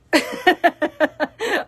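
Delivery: bright high burst of laughter; laughing at the church voice towel; high loud laugh. Real, not acted.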